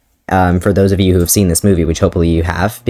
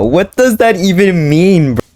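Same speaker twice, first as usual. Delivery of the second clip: slow, high incredulous; mock outrage; 9 semitones higher than usual. Real, not acted. Reading her old fanfic, she mocks it in outrage. Mocking own writing.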